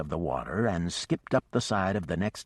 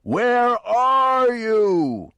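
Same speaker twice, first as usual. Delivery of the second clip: very slow, high, loud drawn-out call; operatic yell; 10 dB louder, much slower than usual. Acted. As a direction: stretch every word in a huge call. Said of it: "he yelled again".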